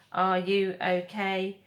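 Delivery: ordinary delivery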